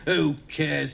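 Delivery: dejected. Acted. Dylan giving up on the story, dejected. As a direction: sigh it away as a glum character. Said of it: "said Dylan dejectedly".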